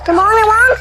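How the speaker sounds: loud warning. Real, not acted. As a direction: call it out in alarm. Scary game, urging not to look.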